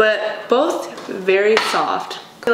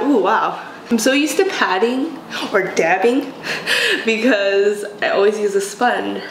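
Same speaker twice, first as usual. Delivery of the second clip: ordinary talk, nothing marked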